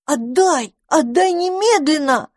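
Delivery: high-pitched urgent demand; crying out. A narrator's reading. Dandelion demands the little dragon back, high cry. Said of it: "закричал одуванчик".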